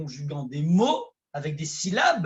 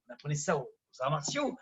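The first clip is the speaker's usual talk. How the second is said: ordinary talk, nothing marked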